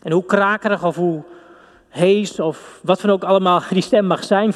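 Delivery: normal talking voice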